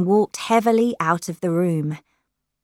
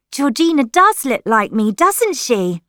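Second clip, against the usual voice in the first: put-on bright, higher-pitched girl's chirp; 7 semitones higher, 6 dB louder than usual. A narrator's reading. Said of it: "said Lily brightly".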